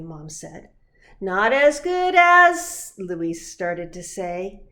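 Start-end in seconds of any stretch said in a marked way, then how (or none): 1.2-2.9 little brother voice. Acted. Luis starting to object.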